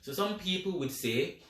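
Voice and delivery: ordinary talk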